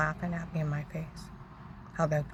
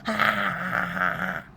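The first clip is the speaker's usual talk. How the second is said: mimicked laughter, dropping in pitch; 2 semitones higher, 4 dB louder than usual. Laugh acted after the plea.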